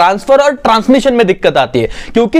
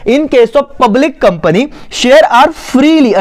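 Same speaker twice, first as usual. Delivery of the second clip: drawn-out, higher-pitched reading of notes; dictation reading; 5 semitones higher, much slower than usual. Acted. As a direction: dictate very slowly, word by word. Reads notes aloud for dictation. (ऊँचे सुर में धीरे-धीरे लिखवाना)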